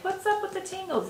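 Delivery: high, mock-puzzled squeak. The listener wondering about tingling, in a high puzzled squeak. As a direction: play a baffled listener in a squeaky voice. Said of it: "and you're like".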